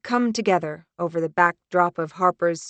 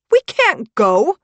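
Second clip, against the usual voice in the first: slow, high, loud protest; shout; 8 semitones higher, 10 dB louder than usual. Acted. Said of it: "I shout".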